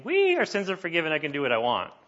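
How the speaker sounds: high, quick gleeful whoop settling down; mock glee. Preacher mimicking believers who abuse grace, mock glee. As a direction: imitate someone gleefully running off carefree. Mimics people running off with forgiveness.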